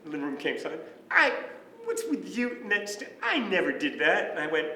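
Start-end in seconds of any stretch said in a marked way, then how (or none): 1.1-4.3 higher-pitched, indignant retold denial; imitating angry dad. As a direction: act out dad getting mad in denial. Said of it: "what are you so mad about".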